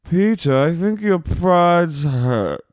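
high, very slow, drawn-out concern; put-on boss. Boss to bartender after his breakup, put-on concern. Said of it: "and she goes".

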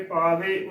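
ਪਾਵੇ as "paa-ve"; wrong two-syllable reading. Shown as a demonstration. Teacher showing the congregation's slip, ਪਾਵੇ as two-syllable "paa-ve". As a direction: fold the e straight into the v of ਪਾਵੇ. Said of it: "ਉਚਾਰਨ ਨਹੀਂ ਕਰਨਾ".

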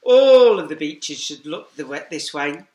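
ordinary delivery

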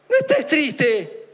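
drawn-out mock sympathy; mock consoler. Mocking a glib consoler who says don't be sad.

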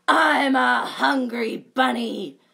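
loud, slow boast; menacing bunny. Acted. Dot scaring the bear, loud and slow. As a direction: growl it proudly.

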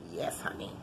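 breathy low whisper. Real, not acted. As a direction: murmur it under your breath. Soft aside to herself.

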